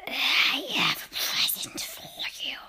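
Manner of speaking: slow whispered witch voice; rasping witch whisper. Acted. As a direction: tempt the boy in a creepy hiss. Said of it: "Her voice had a curious rasping quality".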